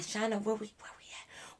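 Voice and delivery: hushed, unvoiced question; acted whisper. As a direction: whisper to wake a friend discreetly. Tapping sleeping friend, asking discreetly.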